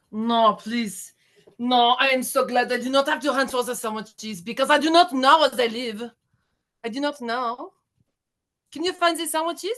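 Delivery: pleading, hammed-up French accent. Shown as a demonstration. Contestant improvising on the sandwich phrase, French accent. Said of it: "you must do it in a French accent".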